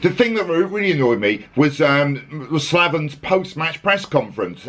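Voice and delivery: ordinary talk, nothing marked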